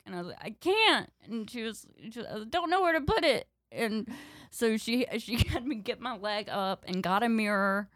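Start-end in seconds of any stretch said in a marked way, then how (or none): 0.4-1.1 put-on higher and louder, panicky kid; whiny refusal. 2.3-3.5 panicky whine. Retold or imitated. Panicked kid protesting.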